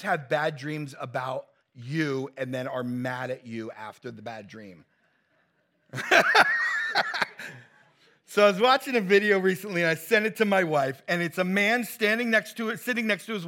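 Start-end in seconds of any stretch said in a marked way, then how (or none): 5.9-7.6 loud laugh. Real, not acted. Laughing at his own joke.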